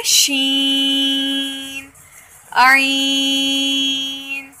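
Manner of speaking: शीन and आइन held for three alifs, slow; drawn-out Tajweed letter drill; elongated letters. Shown as a demonstration. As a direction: stretch each letter name long. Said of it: "तीन अलिफ के बराबर लंबा".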